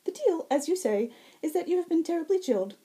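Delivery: high, ringing, lilting fae voice; bright high herald. Acted. Fae herald greeting the wounded Zack, high and bright. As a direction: sing it out high and sweet.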